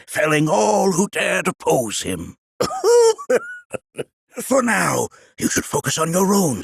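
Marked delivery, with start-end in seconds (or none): 2.6-3.8 put-on sudden high-pitched old man's cackle. Old sage chuckling at Nanaki, high cackle.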